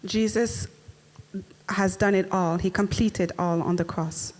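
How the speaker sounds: usual speaking voice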